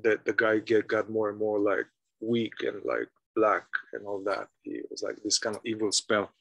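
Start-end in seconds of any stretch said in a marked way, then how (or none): none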